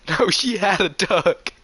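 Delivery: ordinary delivery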